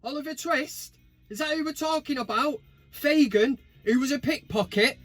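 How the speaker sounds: high squeaky teasing; high-pitched voice. Acted. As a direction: keep the squeaky comic voice going. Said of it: "in that high-pitched voice".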